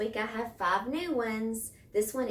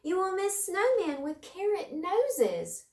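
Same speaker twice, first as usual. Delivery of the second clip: much higher, louder mouse voice; pleading mouse; 11 semitones higher, 5 dB louder than usual. A narrator's reading. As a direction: plead brightly like a tiny mouse. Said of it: "cried Mouse".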